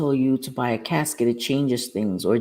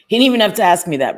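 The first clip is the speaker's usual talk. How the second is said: fast, louder and higher, fed up; exasperated; 6 semitones higher, 8 dB louder, much faster than usual. Real, not acted. Mother venting about her son's needless question, exasperated and fast. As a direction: rattle it off in mock disbelief.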